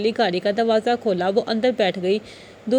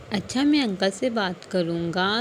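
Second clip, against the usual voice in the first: soft, slow and lower, full of tenderness; affectionate; 4 semitones lower, 3 dB quieter than usual. A narrator's reading. Husband soothing his upset feverish wife, soft and slow. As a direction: ease her gently, like soothing a hurt child. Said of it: "پیار سے کہا".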